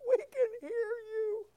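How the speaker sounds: very high, slow quavery granny impression; little old lady. Old lady praising visiting preacher, high put-on voice. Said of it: "little old ladies at the nursing home".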